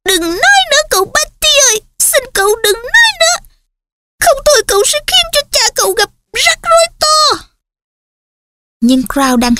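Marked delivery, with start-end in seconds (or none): 0.0-7.5 pleading house-elf. Acted. Frantic elf begs Barty stop.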